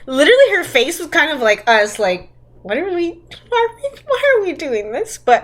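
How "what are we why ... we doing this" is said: quick, high, incredulous stammer; mock bewilderment. Friend retelling the heroine's confused face, mock bewilderment. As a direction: sound baffled on someone else's behalf.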